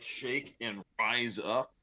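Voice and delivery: ordinary talk, nothing marked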